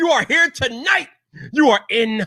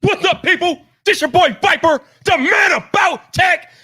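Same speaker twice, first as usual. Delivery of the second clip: hype shout; slower than usual. Real, not acted. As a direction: blast it like a hype man opening the show. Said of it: "don't blow you guys' ears off tonight".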